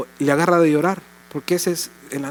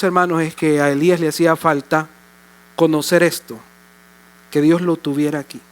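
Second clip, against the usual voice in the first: ordinary talk, nothing marked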